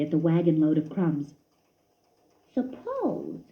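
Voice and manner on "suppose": lightly voiced little mouse thinking; a higher, hushed mouse voice scheming; a scheming little mouse, softly; Christopher's thoughts. A narrator's reading. Christopher's inner voice.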